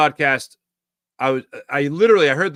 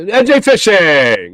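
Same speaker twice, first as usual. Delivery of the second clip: loud, drawn-out hollered greeting; calling out; 4 semitones higher, 8 dB louder, much slower than usual. Real, not acted. Host greets a chat member by name, calling out. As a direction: holler the name and stretch it long.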